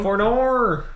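high, loud Australian "For nor". Shown as a demonstration. Goofing on Australian oh no, exaggerated Australian accent. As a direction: stretch "For nor" into a hammed-up Australian wail. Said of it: "like the stereotypical Australian accent".

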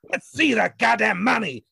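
loud, high, frantic yell; furious shout. A narrator's reading. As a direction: yell it, beside yourself. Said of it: "exclaimed the man, quite beside himself".

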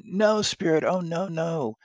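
put-on terrified old miser begging for mercy; pleading. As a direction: beg the spirit not to let it be so. Scrooge begs at his grave.

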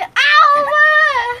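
loud, high startled ow; sudden yelp. Real, not acted. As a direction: yelp in pain. Bumped in cramped closet.

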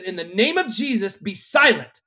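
retold exorcism command, punched at the end; commanding rebuke. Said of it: "Jesus told the demon to be silent".

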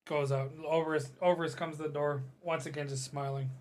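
ordinary talk, nothing marked

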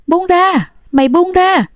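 high-pitched cry at moderate volume; restrained storyteller's scream, pitched well up; narrated scream. A narrator's reading. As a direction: cry out high, dropping sharply at the end. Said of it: "Ả hét lên".

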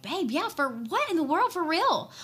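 high, animated, incredulous urging; retold eagerness, pitched well up; emphatic encouragement. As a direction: act out an excited partner pushing the idea. Retells Ryan's eager encouragement.

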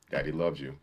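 low, soft menacing dad line; lightly voiced sinister father; creepy dad. Quoting the movie's dad, low and soft.

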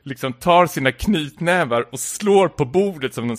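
ordinary delivery

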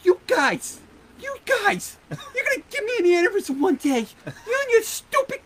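high, exasperated rant that slows at the end; mimicking an exasperated boss. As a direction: squeal it like he is at his wit's end. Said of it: "you know, he goes".

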